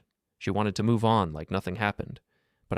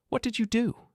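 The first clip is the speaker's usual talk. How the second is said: high, sobbing plea through tears. A narrator's reading. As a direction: cry it out, voice pitched way up. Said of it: "she sobbed".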